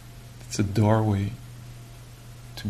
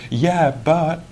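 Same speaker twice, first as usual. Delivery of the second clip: higher, louder protest; objecting mind; 6 semitones higher, 5 dB louder than usual. Retold or imitated. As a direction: cut in as the nagging inner doubter.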